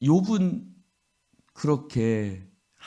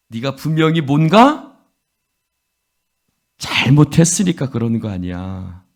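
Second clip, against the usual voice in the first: loud, higher, jabbing accusation; accusing friend; 3 semitones higher, 7 dB louder than usual. Acted. Eliphaz accusing suffering Job, loud and indignant. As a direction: hammer home his guilt.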